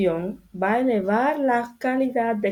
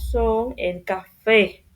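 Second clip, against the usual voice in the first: ejemplo despacio; much slower than usual. Shown as a demonstration. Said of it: "ejemplo dicho despacio".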